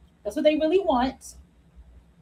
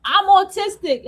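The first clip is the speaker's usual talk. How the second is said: exasperated rant; 6 semitones higher than usual. Retold or imitated. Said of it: "I'm like".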